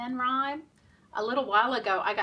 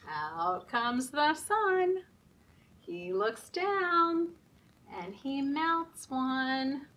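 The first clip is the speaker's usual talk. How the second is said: rhythmic nursery rhyme chant, slow on the sun; sing-song rhyme; 2 semitones higher, 4 dB louder, slower than usual. Acted. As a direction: chant the rhyme for little kids. Reading rhyme at story time.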